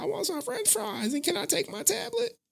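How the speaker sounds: put-on very high little-girl voice; high child voice asking for fries; daughter's voice. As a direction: squeak it like a small daughter asking. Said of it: "She'll be like".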